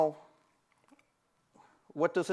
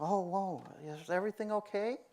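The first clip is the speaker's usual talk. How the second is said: softer, higher, gentle worry; concerned, mirroring; 4 semitones higher, 6 dB quieter than usual. Retold or imitated. Friend checking on a sad friend, soft concern. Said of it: "you're gonna kind of mirror him".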